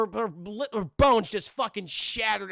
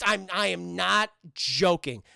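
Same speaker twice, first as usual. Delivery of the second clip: normal talking voice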